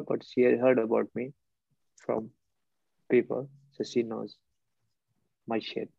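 usual speaking voice

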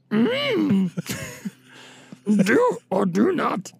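slow, wise little alien hum, lightly voiced; Yoda impression. Doing Yoda for friends who asked, slow and sage-like. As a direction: draw it out slowly like an old sage. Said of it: "I wanna hear your Yoda".

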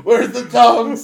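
put-on slow laughing old-man grumble. Jokes about feeling old.

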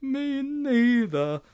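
put-on high, very slow boy's wail; slow high-pitched schoolboy dread; dismayed Sponge. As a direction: stretch out the misery up high.

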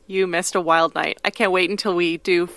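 normal talking voice

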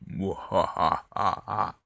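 loud maniacal evil cackle; mad scientist. Acted. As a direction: cackle like a crazed villain. Intro of Goblin's Cave show.

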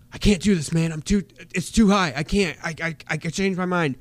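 mock-beg like a scared big guy; scared pleading. Scared wrestler begging on scaffold.

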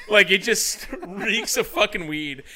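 real amused laughter mid-story; laughing. Real, not acted. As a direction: laugh through the words. Cracking up at own story.

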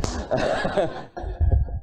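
a short soft laugh at his own joke; short chuckle. Real, not acted. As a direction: chuckle quietly.

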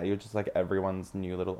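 ordinary delivery

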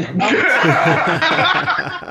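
a big rising burst of laughter; laughing. Real, not acted. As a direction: break into open laughter. Band member laughs at the joke.

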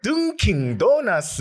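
high, slow puzzled echo of "Dunkin' Donuts", falling at the end; baffled echo. Retold or imitated. Echoing a Daegu guy's words to his friend, high puzzled repeat. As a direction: repeat it back, high and confused. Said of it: "That's how he pronounced it".

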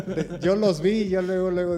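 loud laughing while telling. Real, not acted. Pastor laughing at his Superman costume story, loud laughter. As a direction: laughs through the memory.

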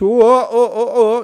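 high loud whooping; excited whoop. Real, not acted. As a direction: cry out with joy. Excited exclamation in podcast intro.

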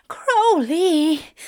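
high, loud protest; crying out. Acted. Aziraphale stopping lover's taunts, crying out. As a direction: cry the name out, high and loud.

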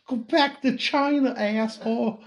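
high mock South Boston accent. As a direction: ham up a South Boston thug. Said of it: "South Boston accent".

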